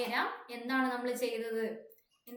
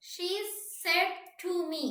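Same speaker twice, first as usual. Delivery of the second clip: higher pitch, each word stretched; slow dictation; 5 semitones higher, much slower than usual. Real, not acted. Teacher writing out the next question, slow dictation. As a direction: dictate it slowly while writing.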